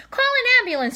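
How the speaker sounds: high, loud shriek; screaming lady. A narrator's reading. Lady screams at the news, high loud shriek. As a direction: scream it above the gasps. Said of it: "screamed one lady".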